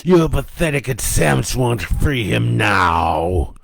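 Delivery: loud, slow demonic voice; taunting devil. A narrator's reading. Devil gloats to Joe over the captive sleeper, taunting. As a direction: gloat slowly and loudly as a devil. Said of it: "the devil taunted".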